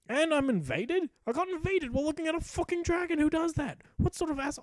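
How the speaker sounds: high, incredulous complaint; indignant outrage. Real, not acted. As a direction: protest the rude invasion. Invaded while watching dragon.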